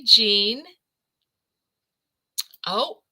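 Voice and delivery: ordinary delivery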